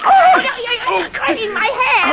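high strained cry of pain while struggling; panicked pain. Acted. Wife hurt in the struggle over the gun, high pained panicked cry. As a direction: yelp as he twists the gun from her grip.

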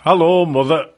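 a light northern greeting, "mother" said as the northern "mother"; northern accent. Retold or imitated. Radio presenter mimicking a husband greeting his wife, light northern accent. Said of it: "except not in that accent, of course, 'cause that's more northern".

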